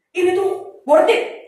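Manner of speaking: eager urging. Retold or imitated. Mentor urging, retold.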